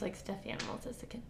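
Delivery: gossipy whispered aside. Real, not acted. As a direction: drop to a hushed whisper. Conspiratorial guess about author.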